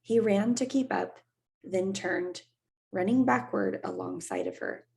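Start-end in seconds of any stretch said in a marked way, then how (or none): none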